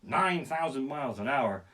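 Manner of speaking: ordinary talk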